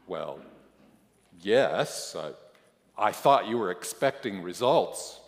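hesitant, halting reply; stammering. A narrator's reading. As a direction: stammer with pauses between false starts. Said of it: "I stammer".

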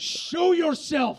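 slow high mock demand; shaky shouting at intruder. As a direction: call them out like a sheriff. Crowd work, calling out audience.

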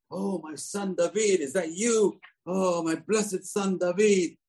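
high, slow, sugary fatherly voice; sweet old king. Acted. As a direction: coo to him like a loving father. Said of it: "that's so, so sweet".

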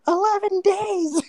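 high, drawn-out creature voice; squeaky kobold. Acted. Kobold answering how long it lives, squeaky. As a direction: squeal it slowly like a silly kobold. Mocks short-lived, dim kobold.

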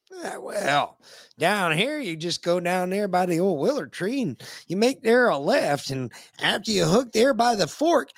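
put-on old country local. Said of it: "you ask 'em".

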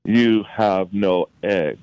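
very slow, final, every word spaced; slow pronouncement. Retold or imitated. Mentor answering the young man's question, slow and final. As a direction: deliver the verdict slowly and let it land.

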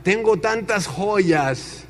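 slow, drawn-out showman's brag; boastful American speaker. Retold or imitated. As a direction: stretch each phrase grandly. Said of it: "se arranca este americano diciendo".

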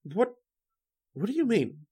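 high-pitched, startled questioning; alarmed. Acted. Writer warned off the phone by intruder, alarmed.

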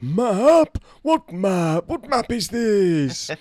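high, loud disbelieving Map?; shocked Dumbledore. Retold or imitated. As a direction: Dumbledore suddenly startled and suspicious. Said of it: "And Dumbledore's like".

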